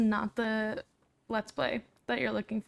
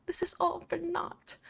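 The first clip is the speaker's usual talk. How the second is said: mock Scottish accent; 8 semitones higher than usual. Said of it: "Maybe I'll do a couple of words here and there".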